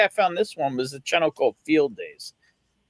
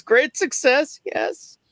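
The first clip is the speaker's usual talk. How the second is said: very high, put-on foreign character voice; high-pitched triumphant mock accent; mock Kazakh catchphrase; 12 semitones higher, much slower than usual. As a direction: deliver it as a comic Kazakh catchphrase. Repeats catchphrase as suggested reply.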